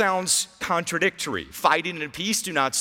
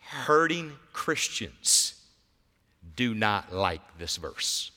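hushed intimate preaching; 7 semitones lower, 6 dB quieter than usual. Real, not acted.

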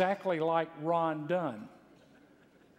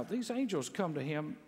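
ordinary talk, nothing marked

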